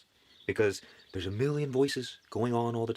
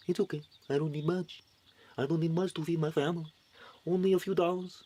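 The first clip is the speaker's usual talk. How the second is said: a light imitated Mexican accent, pitched higher; 6 semitones higher than usual. Mimicking a humble Mexican immigrant worker, light Mexican accent. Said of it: "Let me get my Mexican accent in order".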